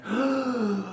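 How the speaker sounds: sharp high gasp like a rescued swimmer; acted gasp for air. Coach acts a drowning listener's first breath: sudden gasp. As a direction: gulp in a breath of life. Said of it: "you take these breaths of life".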